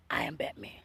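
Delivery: very low superhero impression; Batman. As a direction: drop deep for a mock superhero.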